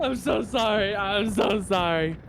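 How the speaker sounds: high, pleading apology; plaintive mock apology. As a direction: beg forgiveness in a high, pained voice. Retold apology after killing in game.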